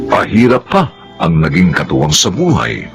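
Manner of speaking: usual speaking voice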